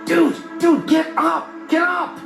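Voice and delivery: high-pitched, frantic wake-up call; excited high-pitched yelling; urgent shouting. A narrator's reading. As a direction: rouse a sleeping roommate in a panic. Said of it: "Why are you shouting?".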